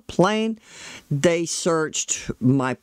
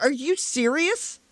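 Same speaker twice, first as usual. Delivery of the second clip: retold pissed-off disbelief; 8 semitones higher than usual. Traveler reacting to the swab result, retold irritation. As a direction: replay being annoyed, voice pitched up. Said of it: "I was getting pissed".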